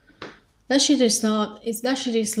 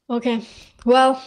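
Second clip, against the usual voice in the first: hoarse, fading voice; losing voice; 5 dB louder than usual. Real, not acted. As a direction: voice giving out. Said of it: "I'm losing my voice".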